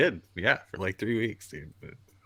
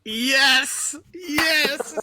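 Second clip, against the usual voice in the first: much higher, excited shouts; triumphant yes; 14 semitones higher than usual. Real, not acted. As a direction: cheer it out in triumph.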